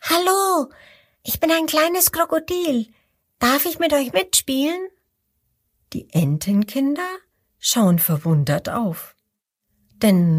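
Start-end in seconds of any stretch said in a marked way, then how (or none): 0.0-4.9 high, friendly tiny voice; friendly little crocodile. Acted. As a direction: greet the ducklings kindly in a small high voice. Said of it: "sagt es freundlich".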